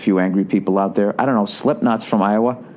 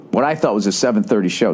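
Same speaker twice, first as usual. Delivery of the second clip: ordinary talk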